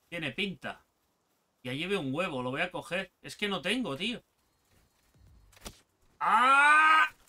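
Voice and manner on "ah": high, loud startled cry; startled pain yelp. Real, not acted. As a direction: yelp as it burns. Burned in game.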